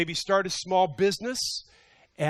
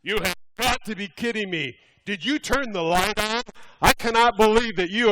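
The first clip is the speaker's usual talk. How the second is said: loud, higher indignant reading of an angry email; all caps rant; 5 semitones higher, 5 dB louder than usual. Retold or imitated. Pastor reading a furious email aloud, mock outrage. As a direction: read it like it's typed in all caps, outraged. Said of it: "All caps".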